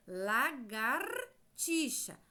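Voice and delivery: Lagartixa said very slowly, the gar with its R held; slow word demo. Shown as a demonstration. Teacher to children, slow Lagartixa to show the ar. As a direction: draw out Lagartixa, leaning on gar. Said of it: "nós temos o ar".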